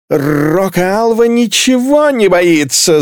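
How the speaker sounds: high, stretched-out howl; drunken yell. A narrator's reading. Drunk girl cheers the marshal, yelling long and high. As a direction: yell it in tipsy hero worship. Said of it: "завопила Клелия".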